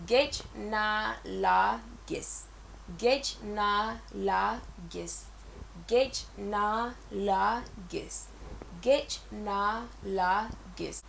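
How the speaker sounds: each syllable of "Gej na la gis" said slowly and clearly; slow repeat. Shown as a demonstration. Teacher drilling learners slowly on Gej na la gis. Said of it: "they will say".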